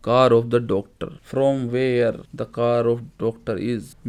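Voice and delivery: very slow, word by word; slow classroom dictation. Shown as a demonstration. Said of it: "slow translation for students".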